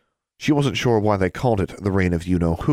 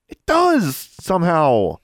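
high, loud, drawn-out retort; exasperated outburst of contradiction; heated insistence; 11 semitones higher, 4 dB louder, much slower than usual. Acted. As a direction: insist stubbornly, pitch jumping up.

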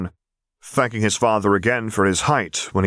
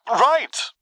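very high, tiny squeak. A narrator's reading. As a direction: squeak out one word. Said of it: "Quinn finally squeaked".

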